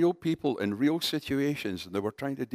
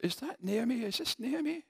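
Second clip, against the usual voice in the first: much higher, softer, doubting question; surprised; 12 semitones higher, 5 dB quieter than usual. Retold or imitated. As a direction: peer at her and ask in disbelief. Villager squints, unsure it's Naomi.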